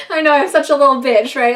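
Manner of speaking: amused, embarrassed outburst; laughing self-mockery. Real, not acted. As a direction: laugh at yourself while admitting it. Laughing at own crying.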